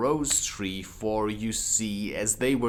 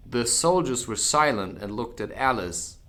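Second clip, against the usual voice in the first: usual speaking voice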